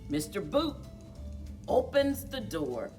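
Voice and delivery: ordinary delivery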